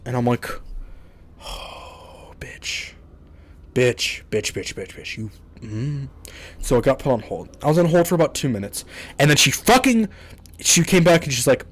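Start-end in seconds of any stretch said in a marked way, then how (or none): 1.4-6.1 cursing under the breath, barely voiced; hushed, bitter swearing into the phone; muttered curses. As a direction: grumble it to yourself so the agent can't hear. Muttering curses while put on hold.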